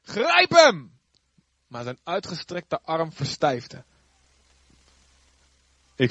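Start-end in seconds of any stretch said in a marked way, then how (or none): 0.0-1.0 much higher, loud royal order; commanding shout. Acted. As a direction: bark the order like an angry king. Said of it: "en beval". (boze koning die luid beveelt)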